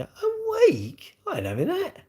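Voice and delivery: incredulous high-pitched retort; mock outrage. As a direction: scoff at a week-long wait in disbelief. Mock outrage at slow finance.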